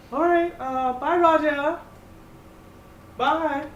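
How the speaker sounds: high, loud sing-song goodbye; cheery farewell. Acted. Finn sending off the constable, high and loudly cheerful. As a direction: wave him off with cheery relief. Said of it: "the guy's like".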